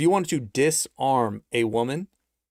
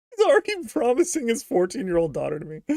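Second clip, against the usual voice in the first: laughing; 8 semitones higher than usual. Real, not acted. Laughing in disbelief.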